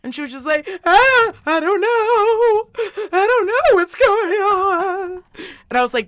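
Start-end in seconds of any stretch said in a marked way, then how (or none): 0.8-1.3 loud, high wail; mock wail. Mom imitates her tearful daughter, mock wailing. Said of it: "she's just got, like, tears". 1.4-3.1 high, loud, drawn-out whine; mock tearful wail. Mom imitates crying daughter before school, mock wailing. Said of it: "she's just got, like, tears". 3.1-5.2 high, loud, drawn-out whine; mock tearful wail. Mom imitates crying daughter before school, mock wailing. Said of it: "she's just got, like, tears".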